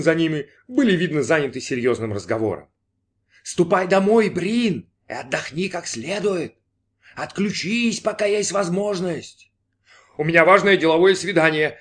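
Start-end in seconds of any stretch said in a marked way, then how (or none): 3.4-9.5 high, drawn-out voice in the head; coaxing inner voice. Acted. Inner voice sends Brin home to rest, high and coaxing. 10.2-11.8 a touch of nerves, higher and louder; trembling voice. A narrator's reading. Brin protests to the unseen voice, mildly trembling. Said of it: "отвечал Брин дрожащим голосом".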